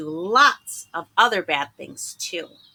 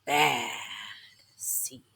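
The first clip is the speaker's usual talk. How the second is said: dramatic title reading; 6 semitones lower, slower than usual. A narrator's reading. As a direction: announce it big then sink low. Title line, dramatic then hushed.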